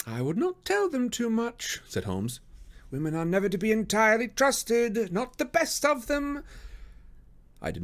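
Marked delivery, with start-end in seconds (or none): 0.1-1.8 high, slow detective voice; Holmes, cautioning. Acted. Detective warns friend about confiding, high and slow. 2.9-6.4 very high detective voice, slowing down; Holmes, distrustful. Acted. Detective warns friend against trusting women, high and slowing.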